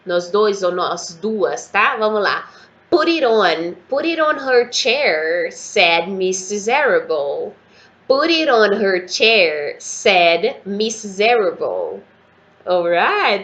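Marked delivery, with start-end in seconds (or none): 2.9-12.0 slow, careful model reading for students; slow pronunciation drill. Shown as a demonstration. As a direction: read it slowly so the class can repeat. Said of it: "Vamos de pronúncia, nós dois". (leitura lenta e modelada para os alunos repetirem)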